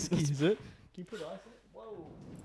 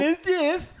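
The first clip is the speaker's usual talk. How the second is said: pitched-up silly voice; a mocking high-pitched voice; 12 semitones higher, slower than usual. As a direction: squeak it out like a cartoon.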